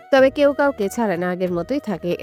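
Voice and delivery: usual speaking voice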